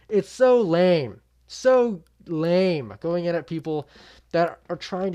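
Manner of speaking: really fed up, let it rip; exasperated outburst. Real, not acted. Ranting to viewers about the accent jab, loud and exasperated.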